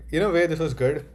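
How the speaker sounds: ordinary delivery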